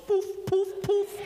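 quick little high puffs of sound; high-pitched poofs. Retold or imitated. Preacher mocking drawn-on eyebrows to congregation, high-pitched poofs. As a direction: poof it out high.

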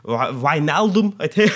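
put-on Dutch accent. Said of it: "I'm trying to put a bit of a Dutch accent on here".

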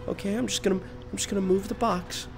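soft, careful coaxing voice; soothing a dangerous creature quietly; hushed coaxing. Acted. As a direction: tiptoe in with your voice. Gently approaching scary little brother.

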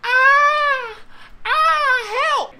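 high, loud screams for help; piercingly high acted panic scream; running scream. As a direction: shriek like a fleeing child. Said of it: "So I'm screaming, running".

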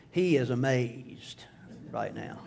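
hushed, dropping to a whisper; whispered thought. Retold or imitated. Onlookers' imagined thought, whispered softly. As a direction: breathe it like a secret aside.

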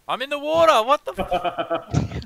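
high, loud startled yell; panicked shout. Real, not acted. Falls into water in game.

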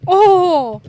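loud high sudden oh; startled shout. Real, not acted. Gamer startled by a kill, loud high shout. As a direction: jolt out a loud oh.

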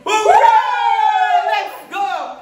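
high, cheering hooray; excited cheer. Acted. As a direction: cheer it out like a kickoff. Kicking off the challenge.

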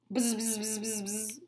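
low, soft buzzing; mower buzz. Acted. Lawnmower buzzing softly. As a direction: drone like a mower.

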